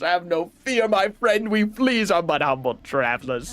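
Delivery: lightly put-on European accent, slow start; fake European accent. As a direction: read the flea like a vague European traveler. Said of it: "if you thought that accent was racist".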